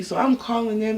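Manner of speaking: ordinary talk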